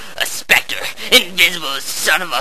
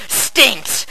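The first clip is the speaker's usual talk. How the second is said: usual speaking voice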